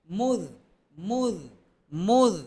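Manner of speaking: মুজ with a light, thin র sound; light pronunciation. Shown as a demonstration. Teacher shows how a light letter would sound, thin. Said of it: "তাহলে কি করতাম আমি".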